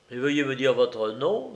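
usual speaking voice

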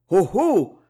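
ordinary delivery, nothing marked

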